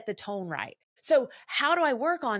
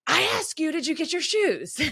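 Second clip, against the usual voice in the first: higher, louder exasperated snap; mom snapping; 7 semitones higher, 5 dB louder than usual. Retold or imitated. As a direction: bite it off like a mom at the end of her rope. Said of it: "sometimes you just snap".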